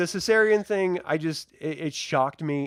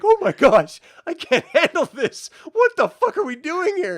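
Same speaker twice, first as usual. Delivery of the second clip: higher and louder, half-laughing panic; overwhelmed outburst; 11 semitones higher, 6 dB louder than usual. Retold or imitated. Friend retelling her shocked reaction to the scene, high laughing panic. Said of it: "I was just like".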